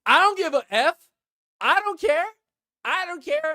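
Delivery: high-pitched, defiant mock shout; imitated loud, brazen outburst; very loud retort. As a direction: shout it as an oblivious loudmouth. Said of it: "And she said so loud".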